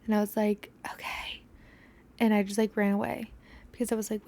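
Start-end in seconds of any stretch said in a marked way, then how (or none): 0.9-1.4 whispered okay. Retold or imitated. Sheepish reply to the DoorDasher.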